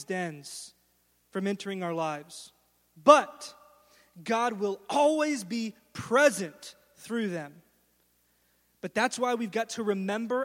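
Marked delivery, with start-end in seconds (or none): 3.0-7.6 loud burst, then slow and deliberate; preaching emphasis, slowed; emphatic proclamation. Real, not acted. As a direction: drive the promise home. Preacher's climactic declaration.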